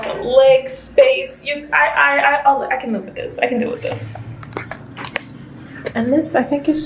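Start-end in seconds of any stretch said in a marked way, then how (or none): 2.6-4.1 muttered aside. Real, not acted. Resigned aside to herself.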